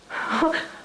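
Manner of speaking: short breathless laugh of shock; a helpless little laugh at bad news; nervous laugh. Real, not acted. Hearing grim news about her father, a shocked breathless laugh. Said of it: "I keep laughing in shock".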